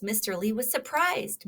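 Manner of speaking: plain reading voice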